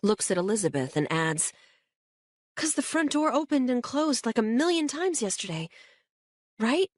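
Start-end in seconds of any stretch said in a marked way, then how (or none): 2.5-6.9 put-on high, hesitant girl's voice fumbling for an excuse; a teen groping for a weak explanation; Kate, nervous teen. A narrator's reading.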